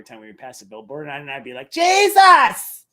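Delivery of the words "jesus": loud, high cry of Jesus; mock exclaiming shout. Streamer re-enacting passing a billboard, mock shout. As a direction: holler it out like a billboard sighting. Acting out reaction to each billboard.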